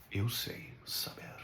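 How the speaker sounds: very soft, slow, low whisper; near whisper. Acted. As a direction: let it drop to a slow hush. Voices knowing, as conclusion.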